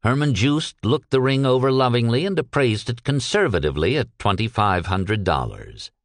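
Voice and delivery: plain reading voice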